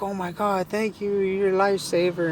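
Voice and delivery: high, gushing imitation of her thanks; imitated grateful woman. Woman thanks him for the repair, imitated high and grateful. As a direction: pitch it way up as the relieved woman. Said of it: "she's like".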